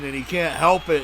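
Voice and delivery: ordinary delivery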